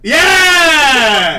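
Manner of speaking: big excited shout; triumphant yell. Real, not acted. Goat sound guess celebrated.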